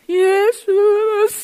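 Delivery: mock loud cry of Jesus. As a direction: call out for help. Man cries out Jesus.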